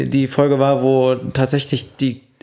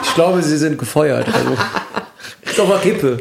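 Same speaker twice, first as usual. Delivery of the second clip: mock boss firing.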